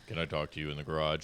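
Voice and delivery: low, fast mock boss voice, calm and serious; deep, quick put-on manager tone; lightly imitated manager voice. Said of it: "[name] manager voice".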